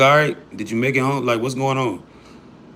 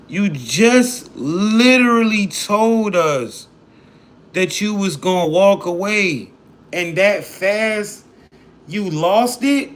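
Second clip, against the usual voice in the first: drawn-out disbelief; 7 semitones higher, slower than usual. Real, not acted. Host disbelieving the caller's meltdown.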